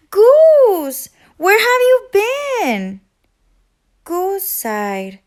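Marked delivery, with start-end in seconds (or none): 0.1-3.0 high, loud relieved cry; relieved shout. A narrator's reading. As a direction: burst out in relief at finding him. Duck finds his friend.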